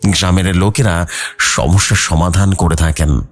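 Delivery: usual speaking voice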